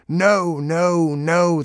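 loud, high dog howl; booming, howling protest; howled refusal. A narrator's reading. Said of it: "the dog howled".

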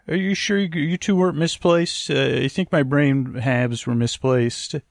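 ordinary talk

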